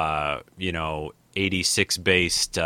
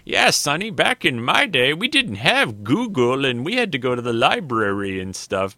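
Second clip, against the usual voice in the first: put-on old man voice; 6 semitones higher, 3 dB louder than usual. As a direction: talk like an old-timer telling a youngster.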